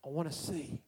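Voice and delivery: soft, low, simple wish; tender plea. Acted. Blind man answering Jesus, soft and low. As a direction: say it almost under the breath. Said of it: "Can you imagine that?".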